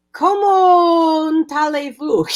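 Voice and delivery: very slow, drawn-out French; crappy French. Acted. Woman mocking her rusty high-school French, labored accent. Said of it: "defaults to my crappy French".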